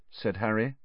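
usual speaking voice

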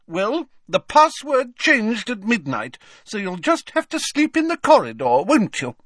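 high haughty lady voice; Fat Lady voice. Acted. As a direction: play a prim, put-out portrait lady.

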